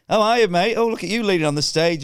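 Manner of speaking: high, loud cheery hail; bright showbiz hello, pitched right up; chirpy stage greeting. Retold or imitated. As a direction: greet the punter with big forced cheer. Acts cheery stage greeting to punter.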